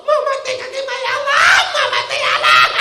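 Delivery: put-on high wailing child voice, laughing through it; mock crying child. Preacher mimics a child crying for mother, high mock wail. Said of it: "Anak nangis".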